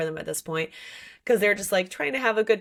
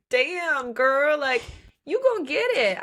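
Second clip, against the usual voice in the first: put-on higher, hyped-up girlfriend voice; mock cheering friend; 6 semitones higher, 3 dB louder, slower than usual. Reader cheering Olivia on in a mock hype voice. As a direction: cheer her on like a best friend.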